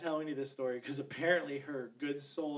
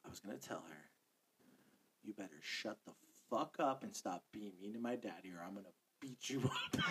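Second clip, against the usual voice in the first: hushed, low imitated child's threat; retold stage whisper; 7 semitones lower, 11 dB quieter than usual. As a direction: whisper it just loud enough to be heard. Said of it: "whisper loud enough for both me".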